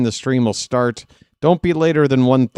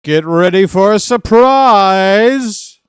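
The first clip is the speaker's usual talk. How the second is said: high, loud, slow movie-villain shout; hammed-up Total Recall shout; Total Recall quote; 8 semitones higher, 9 dB louder, much slower than usual. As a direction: bellow it like the film line. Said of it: "any Total Recall fans out there?".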